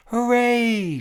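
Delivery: high loud joyful shout; king cheering from his throne; shouted hooray. A narrator's reading. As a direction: shout it to the sky. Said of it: "shouted Yertle".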